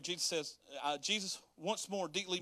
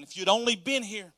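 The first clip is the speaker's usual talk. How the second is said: tearful loud plea; 5 semitones higher, 8 dB louder than usual. Acted. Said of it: "She was crying".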